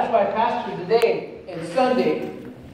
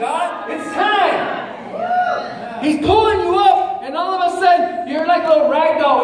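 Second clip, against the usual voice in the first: full-force preaching; 7 semitones higher than usual. Acted. Preacher urging believers to get up, high preaching. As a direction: drive it home to the pews.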